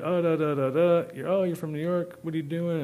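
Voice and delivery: lightly voiced chatty cop; imitating the cop. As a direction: cop quizzing the New Yorker, mock-excited. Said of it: "Just said".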